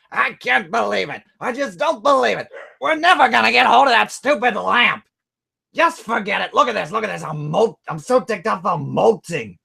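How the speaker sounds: squawky high parrot echo. Retold or imitated. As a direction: screech it back like a parrot. Parrot ranting.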